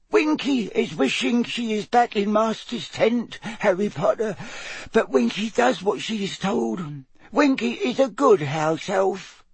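high, dutiful, wistful elf voice; frightened elf squeak. A narrator's reading. Frightened house elf reassures herself of duty, squeaking. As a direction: squeak it bravely despite the fear. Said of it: "gave the edge of the box another frightened look".

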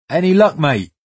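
put-on West African accent. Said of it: "comes a thick West African accent".

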